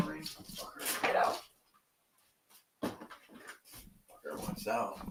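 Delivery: unvoiced whisper to the dog; whispered slow aside about the dog. Real, not acted. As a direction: hush it under your breath.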